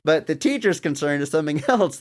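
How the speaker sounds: normal talking voice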